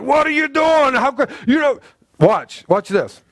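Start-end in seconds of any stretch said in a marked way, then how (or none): 0.0-1.8 disciples' outraged cry, voice pitched high; indignant. Retold or imitated. Preacher voicing the indignant disciples to the congregation. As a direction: act out the shocked objection. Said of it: "they were indignant".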